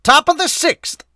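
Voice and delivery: high, loud announcer call; inning announcer voice. Shown as a demonstration. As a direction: call it out over the stadium PA. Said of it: "I'm gonna use that voice to do it".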